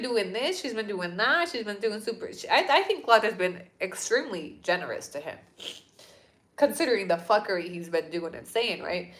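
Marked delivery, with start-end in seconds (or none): none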